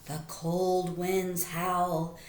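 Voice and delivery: ordinary delivery, nothing marked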